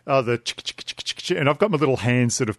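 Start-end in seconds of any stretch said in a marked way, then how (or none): 0.4-1.4 whispered clicking bee sounds, on request; bee impression. Shown as a demonstration. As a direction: click out the bee noise again. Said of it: "Can you do your bee impression again".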